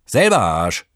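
laute, trotzige Retourkutsche; zurückgerufen. Acted. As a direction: ruf es einfach zurück. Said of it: "rief der andere zurück". (Betrunkener ruft dem Fahrer zurück, laut)

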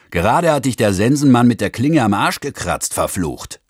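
lauter, höher gebrüllter Anpranger; brüll ihn aus dem Fenster an; brüllend. Acted. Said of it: "und brüllte". (Fahrer brüllt den Neuen aus dem Lastwagen an, laut)

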